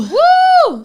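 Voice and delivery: high loud woo; cheering whoop. Real, not acted.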